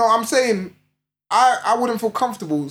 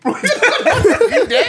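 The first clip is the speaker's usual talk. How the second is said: laughing mock squeal; 13 semitones higher, 4 dB louder than usual. Mocking a girl walking in.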